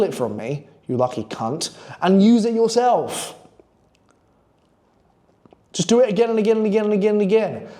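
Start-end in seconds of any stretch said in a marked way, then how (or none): 5.7-7.7 fast, run-together chant of the same words; rapid-fire repetition. Real, not acted. Coach urges viewers to keep repeating it, rapid-fire. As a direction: rattle it off without a break. Rattles off endless repetition for effect.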